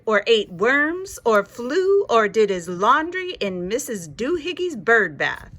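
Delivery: ordinary delivery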